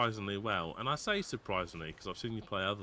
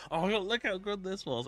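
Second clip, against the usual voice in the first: excited; 8 semitones higher than usual. Retold or imitated. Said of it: "I was like".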